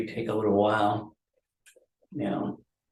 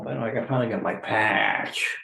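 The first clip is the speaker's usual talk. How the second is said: ordinary delivery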